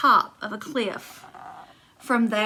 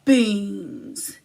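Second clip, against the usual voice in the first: grumbled; 3 semitones lower than usual. Acted. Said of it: "he grumbled".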